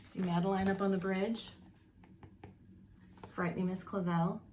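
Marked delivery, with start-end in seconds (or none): none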